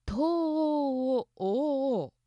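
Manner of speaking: 東欧をおお stretched very slowly, every お held and marked; 東欧をおお as one long sung-out o-chain; drawn-out vowels. Shown as a demonstration. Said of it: "同じ音が続く一番長い".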